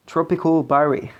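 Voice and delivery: Tropical Barry said with an English accent, not French. Shown as a demonstration. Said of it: "avec mon petit accent anglais".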